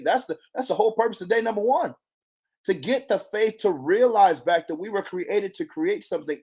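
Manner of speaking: ordinary talk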